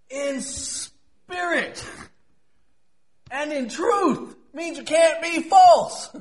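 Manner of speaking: high, slow, drawn-out declaration; ringing, emphatic preacher delivery; impassioned preaching. Real, not acted. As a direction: hammer each phrase from the pulpit. Preaches at full force.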